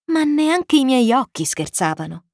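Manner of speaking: ordinary delivery, nothing marked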